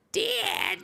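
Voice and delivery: put-on high, boyish call of Dad; Bobby Hill impression. Podcaster trying Bobby calling his dad, high boyish yell. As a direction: yell Dad like a whiny kid. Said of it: "I kinda wanna do Bobby now".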